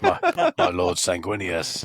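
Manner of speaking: slow, solemn Space Marine address; a hint of a grave warrior; deep Space Marine voice. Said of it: "Dark, deep".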